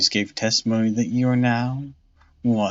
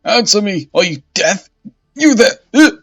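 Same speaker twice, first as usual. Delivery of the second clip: loud, high, frantic demands; panicked shouting; 11 semitones higher, 10 dB louder than usual. Acted. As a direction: yell in confused terror. Bishop wakes up confused.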